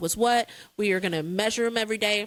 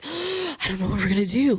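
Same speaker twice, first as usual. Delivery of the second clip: dramatic husband. Retold or imitated. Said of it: "my husband's pretty dramatic".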